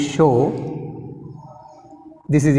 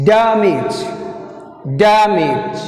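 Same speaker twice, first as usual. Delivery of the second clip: "Damage" with a held DAM, then a fall; teacher modelling "Damage", stressed at the start; first-syllable stress; 2 semitones higher, much slower than usual. Shown as a demonstration. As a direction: lean hard on DAM in "Damage". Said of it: "Stress is first syllable D".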